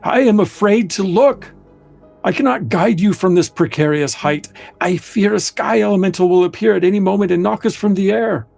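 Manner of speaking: a frightened companion begging; nervous Embrel voice. Acted. GM voices Embrel's fearful plea.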